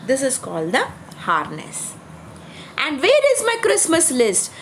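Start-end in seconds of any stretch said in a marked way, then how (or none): 2.8-4.5 higher, mildly fretful Santa; Santa crying out. Acted. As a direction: call out lost in the fog. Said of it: "cried Santa".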